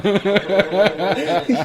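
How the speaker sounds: high, loud burst of laughter; laughing at his own singing in the bar. Real, not acted. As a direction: laugh hard at yourself.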